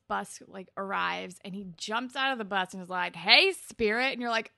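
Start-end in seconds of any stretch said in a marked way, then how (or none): 3.1-4.1 loud, higher mock greeting; imitated call-out. Dean hails her off the bus, mock call-out. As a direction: call out loudly, imitating him.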